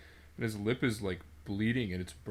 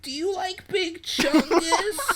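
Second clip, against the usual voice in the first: mock kid voice; 9 dB louder, slower than usual. Mocking a middle schooler.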